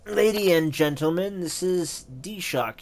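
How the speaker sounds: formal announcement. Acted. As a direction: call the room to attention, then present the guest.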